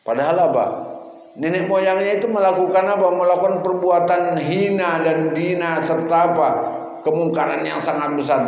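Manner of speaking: loud, high-pitched sermon delivery; impassioned preaching. Real, not acted. Preacher denouncing the forefathers' sin to the congregation, impassioned.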